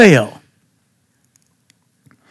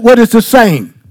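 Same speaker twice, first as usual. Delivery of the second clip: ordinary talk, nothing marked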